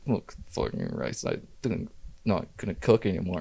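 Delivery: low unvoiced drunk mumble; murmured. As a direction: grumble it under your breath, defeated. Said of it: "I just kinda murmured".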